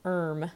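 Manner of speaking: "erm" for arm, with a dulled vowel; odd pronunciation. A narrator's reading. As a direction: say "erm" as the servant's way of saying arm. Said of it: "He pronounced it".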